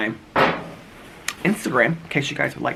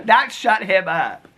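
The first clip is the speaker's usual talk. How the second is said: ordinary talk, nothing marked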